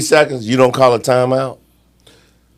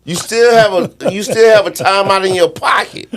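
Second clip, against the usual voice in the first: much higher, incredulous pitch; exasperated disbelief; 9 semitones higher than usual. Real, not acted. Analyst arguing a timeout was still available, exasperated. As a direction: plead the obvious in disbelief.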